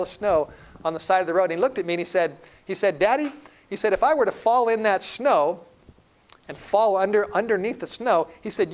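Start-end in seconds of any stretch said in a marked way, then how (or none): 3.0-3.3 put-on high child's voice calling his dad; young son. Son calling to his father on the sled, high child voice. 3.9-8.3 put-on high, louder little-boy voice; young son. Son asks dad about falling in snow, high child voice. Small son's question in story.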